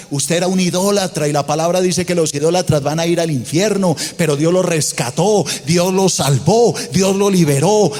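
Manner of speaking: loud triumphant sermon, pitch climbing; full-force preaching. Acted. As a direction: proclaim it like a victory.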